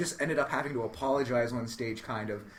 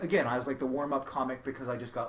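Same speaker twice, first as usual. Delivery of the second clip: normal talking voice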